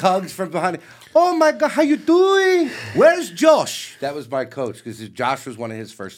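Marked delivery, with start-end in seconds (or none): 1.1-2.7 put-on high, loud, slow Brazilian accent; hammed-up Brazilian accent of an old master; bad Brazilian accent. Retelling meeting Renzo Gracie, in a hammed-up Brazilian accent. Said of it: "It's a horrible Brazilian accent". 2.9-3.9 high, loud Brazilian Where's Josh; hammed-up Brazilian accent; bad Brazilian accent. Retelling Renzo asking about his coach, in a hammed-up Brazilian accent. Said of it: "It's a horrible Brazilian accent".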